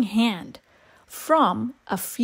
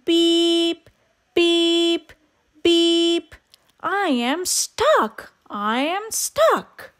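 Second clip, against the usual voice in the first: mild worried truck; 7 semitones higher, slower than usual. A narrator's reading. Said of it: "cried the little blue truck".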